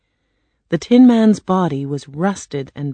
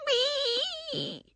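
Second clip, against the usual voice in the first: very high witch cry, fading away; melting witch's wail; 4 dB quieter, slower than usual. A narrator's reading. Witch melting in water, high fading wail. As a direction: wail and let it die off softly. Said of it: "the witch cried".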